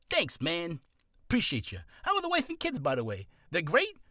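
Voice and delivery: high, sing-song New York small talk; hammed-up New York chatter; New York accent. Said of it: "Don't know why I'm doing a New York accent".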